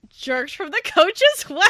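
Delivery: storyteller swell on the reveal; dramatic build. Real, not acted. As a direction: push it up like a cliffhanger. Dramatic retelling of the scene.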